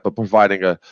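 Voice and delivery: usual speaking voice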